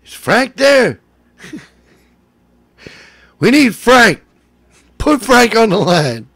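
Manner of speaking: high, loud mock demand; imitated screaming. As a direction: yell for Frank, hammed-up. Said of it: "he starts screaming about Frank".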